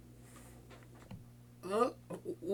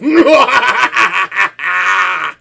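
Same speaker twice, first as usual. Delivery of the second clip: big, loud gloating cackle thrown out wide; flamboyant villain laugh; 2 semitones lower, 11 dB louder than usual. Acted. Villain to his hostage, bragging, loud gloating laugh. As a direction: laugh like a showy delinquent savoring his own style.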